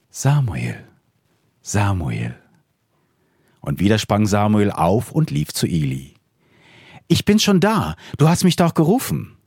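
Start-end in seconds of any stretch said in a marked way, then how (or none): 0.1-2.3 put-on God calls the sleeping boy by name, slow and solemn; a solemn divine call, unhurried. God calls young Samuel in the night, solemn calling voice. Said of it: "der Herr rief noch einmal". 7.1-9.4 put-on young boy. Young Samuel answers the call, eager high boy's voice. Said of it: "sagte er".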